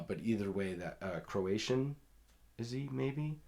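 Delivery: ordinary delivery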